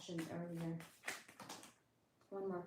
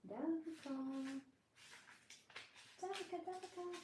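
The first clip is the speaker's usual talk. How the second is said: high-pitched, singsong name call; lilting, much higher summons; 9 semitones higher than usual. Real, not acted. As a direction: call out for someone who may be missing. Calling for an absent viewer.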